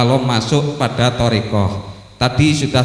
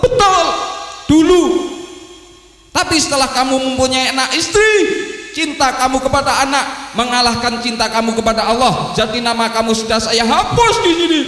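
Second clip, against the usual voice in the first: scolding angel; 9 semitones higher than usual. Acted. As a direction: pronounce judgment like a stern angel. Angel explains Samnun's fault.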